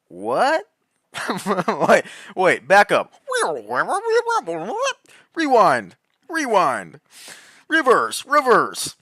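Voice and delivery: high, loud double-take, rewinding the tape; can't believe what he just heard, stop everything; stunned disbelief. Real, not acted. Vlogger demanding a rewind in loud, high disbelief.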